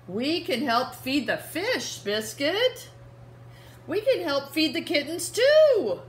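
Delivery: put-on bright little-girl character read to kids. Reading Biscuit to children, the little girl cheerfully calls her puppy. Reading picture book aloud.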